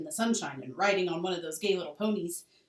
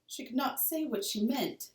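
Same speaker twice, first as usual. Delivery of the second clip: plain reading voice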